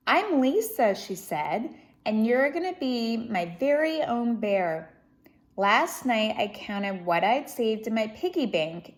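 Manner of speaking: lively, expressive storybook reading; reading to children. Acted. Reading Corduroy aloud to children, lively storybook voice. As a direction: read it out to a young audience with energy. Reads picture book aloud.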